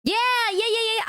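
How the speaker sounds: high, excited string of yeahs; eager agreement. Real, not acted. Friend agreeing to the road trip, eagerly.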